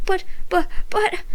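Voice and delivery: high, stuttering buts like a motor; sputtering. A narrator's reading. Ricky sputters at being kicked off, stammering. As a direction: sputter helplessly, stuck on one word. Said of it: "I sputtered it".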